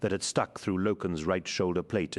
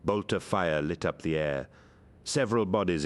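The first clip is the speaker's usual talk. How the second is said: usual speaking voice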